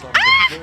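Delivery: startled yelp. Retold or imitated. Friend holding her phone suddenly yelps in alarm.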